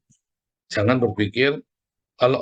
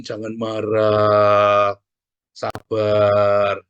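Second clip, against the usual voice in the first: drawn-out, louder mock soothing; 5 dB louder, much slower than usual. The meddler lecturing an angry friend, mock soothing. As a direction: stretch every word like a preachy calmer. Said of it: "Gara-gara kamu omongin".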